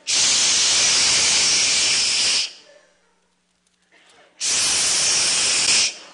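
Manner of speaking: hissed spray-can noise. Retold or imitated. Comic mimics guys spraying body spray, hissed can sound.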